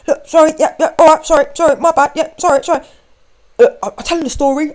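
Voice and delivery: flustered man backpedalling loudly; panicked apology. Retold or imitated. Frantic apology at the door.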